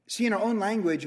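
ordinary talk, nothing marked

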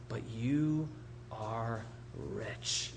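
soft, low, with weighty pauses; hushed, reverent promise; solemn reassurance. Acted. Quoting Jesus's tender assurance.

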